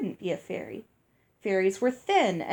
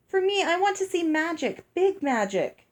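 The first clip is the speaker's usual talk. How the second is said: eager begging; 8 semitones higher than usual. Acted. Child begging to see magic.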